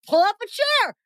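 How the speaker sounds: high-pitched, eager welcome; mock-rowdy invitation. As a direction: wave newcomers in with party energy. Acted invitation to join the fun.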